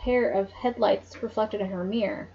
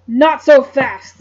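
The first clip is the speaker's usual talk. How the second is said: loud, slow menacing growl; 3 semitones higher, 11 dB louder, much slower than usual. Acted. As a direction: drag it out as a loud threat. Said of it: "the stranger growled in a deep voice".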